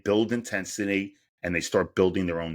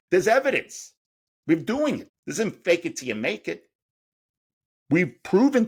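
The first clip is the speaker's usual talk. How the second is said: much higher, louder burst of conviction; fired up, driving the point home; passionate, rising certainty; excited emphasis; 8 semitones higher, 4 dB louder than usual. Real, not acted. Excited conviction about proof.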